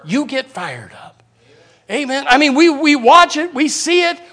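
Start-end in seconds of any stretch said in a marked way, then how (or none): 0.0-1.2 low and slow, dropped far down in volume; hushed reply. Acted. Acted reply to sir, low and hushed. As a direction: say it low and soft, unhurried.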